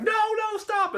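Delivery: very high, loud shriek; imitated cartoon kid screaming; acted panicked scream. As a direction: shriek in mock terror to stop. Acting Jeffy screaming at the drill.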